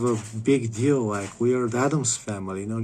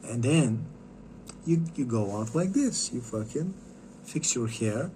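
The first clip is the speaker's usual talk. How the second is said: ordinary talk, nothing marked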